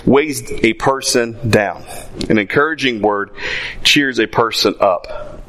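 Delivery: slow, solemn reading of a verse; scripture reading. Acted. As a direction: read the proverb slowly and gravely. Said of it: "Proverbs twelve twenty-five says".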